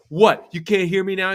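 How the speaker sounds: a loud, high mocking taunt at the fans; taunting jeer. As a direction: goad the crowd after scoring. Striker taunting away fans.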